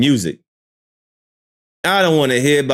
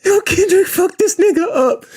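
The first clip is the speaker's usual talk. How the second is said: hype shout. Real, not acted. Hyping up [name] on stream.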